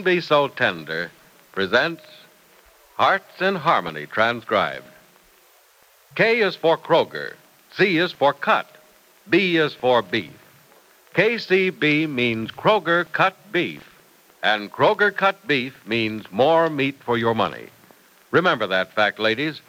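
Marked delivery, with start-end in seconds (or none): none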